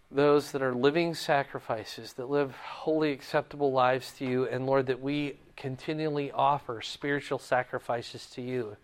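earnest, low-key prayer voice; closing prayer. Real, not acted. Teacher leading the class in a closing prayer, earnest and low-key. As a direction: pray sincerely and unhurried.

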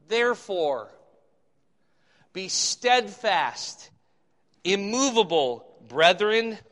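loud, slow, declaimed Bible verse; scripture reading. Acted. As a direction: proclaim it with ringing emphasis. Reads scripture aloud.

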